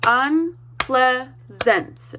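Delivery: Unpleasant drawn out slowly, syllable by syllable; teacher leads the class in Unpleasant, slowly. Shown as a demonstration. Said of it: "Let's do this together".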